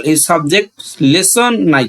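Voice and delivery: ordinary delivery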